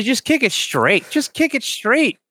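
slow, high, drawn-out pleading; exasperated plea. Acted. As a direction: stretch it out high, begging the kicker. Mock pleading with the kicker.

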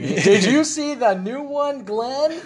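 high, slow, loud and exaggerated; talking to the hard of hearing. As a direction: spell it out for someone who can't hear. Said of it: "like having a friend who's, like, really hard of hearing".

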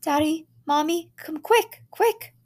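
very high, loud call; children calling. A narrator's reading. Children call their parents, very high and loud. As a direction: shout from far off like a child. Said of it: "heard the children calling".

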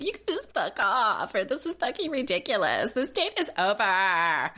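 retelling the date ending in a mock-angry voice; imitating angry Erica. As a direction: act out her blow-up, dragging the last line. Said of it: "she seemed really angry".

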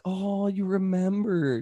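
drawn out, touched that he remembers; touched wife. Retold or imitated. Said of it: "And she's like".

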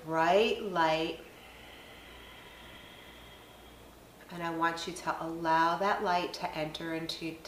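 soft, unhurried meditation voice with long pauses; guided meditation. Acted. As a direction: guide them gently through each breath, leaving space between phrases. Leads a guided breathing meditation.

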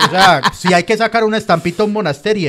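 ordinary talk

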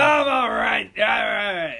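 put-on loud, high drunken insistence; drunk insisting. Drunk guy yelling to his shocked sister that he's fine. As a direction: shout that you're totally fine. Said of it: "And I was like".

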